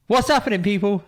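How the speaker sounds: high, lifted show-opener call; crescendo catchphrase. Shown as a demonstration. Co-host performing his intro on request, crescendo effect. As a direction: launch the greeting way up high. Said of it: "crescendo effect".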